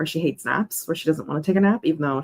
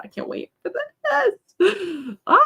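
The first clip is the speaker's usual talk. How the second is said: very high, slow, near-tearful excited squeal; almost crying with excitement; overjoyed squeal; much slower than usual. Real, not acted. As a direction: squeal it out with joy. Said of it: "I'm so excited".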